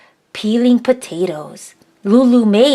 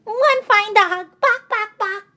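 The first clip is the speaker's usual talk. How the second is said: put-on high, playful baby chant; giggly toddler voice; 12 semitones higher than usual. Baby Berry giggling at the dog, high and playful. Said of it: "Baby Berry giggled".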